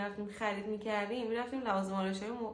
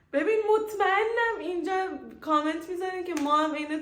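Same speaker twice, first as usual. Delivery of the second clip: excited teasing; 9 semitones higher, 9 dB louder than usual. Real, not acted. Teasing viewers about their comments.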